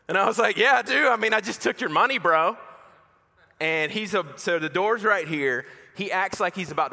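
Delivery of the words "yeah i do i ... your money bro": high-pitched, louder, quick trash talk; retold smug boast, pitched up; cocky comeback. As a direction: rub it in like an arrogant teenager. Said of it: "super arrogant and cocky".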